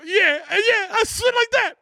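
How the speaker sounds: high, startled, nervous voice. Retold or imitated. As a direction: squeak it out, scared and polite. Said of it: "I'm like".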